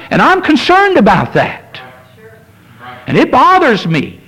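high, pleading pulpit voice, rising as it goes; impassioned preaching. Acted. Pastor pleading with the congregation, rising impassioned preaching. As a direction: pour out the pastor's worry from the pulpit.

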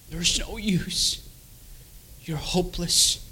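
quiet, slow, measured condemnation; tormenting voice. Retold or imitated. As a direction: imitate a taunting inner voice, softly and deliberately. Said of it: "his voice was still there".

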